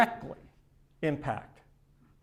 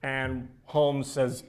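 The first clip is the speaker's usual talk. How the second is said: normal talking voice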